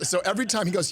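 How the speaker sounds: usual speaking voice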